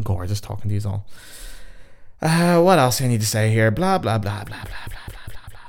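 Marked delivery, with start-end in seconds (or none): 3.7-5.6 blah blah sinking to a whisper; fading blah blah. Pretend. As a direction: let it die away into a whisper. Trailing off rambling filler.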